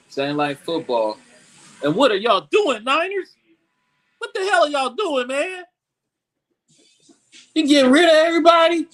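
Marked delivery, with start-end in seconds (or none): 1.8-5.6 pitched up, incredulous; exasperated disbelief. Real, not acted. Rant at the Niners' roster cuts. 7.5-8.9 louder and higher, fast; incredulous outburst. Real, not acted. Rant at the Niners' roster cuts.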